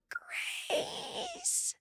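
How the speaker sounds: high crying call; a desperate wail of a name; shrill call. A narrator's reading. Ma's shrill call muffled by the grass, crying. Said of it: "shrill call".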